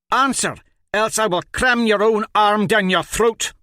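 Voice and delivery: high, loud savage threat; threatening. A narrator's reading. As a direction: roar the threat at him. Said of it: "Spades threatened".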